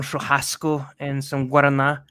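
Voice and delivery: "guaraná" in a Brazilian accent, stressed last; put-on Brazilian accent for the menu words. Shown as a demonstration. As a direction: pretentiously correct Brazilian Portuguese accent. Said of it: "I feel like a douche".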